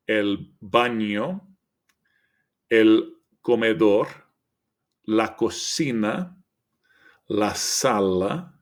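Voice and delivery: each room word read slowly with a rising end; slow teaching read-out. Shown as a demonstration. Teacher reading room words to students, slow and clear. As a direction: clear model pronunciation. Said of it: "All right, so".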